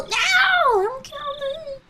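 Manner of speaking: very high squealed plea; panicked pigeon. Acted. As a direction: squeal it like a doomed bird. Acting out a pigeon facing death.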